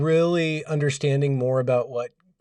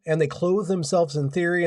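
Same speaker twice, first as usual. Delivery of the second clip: ordinary talk, nothing marked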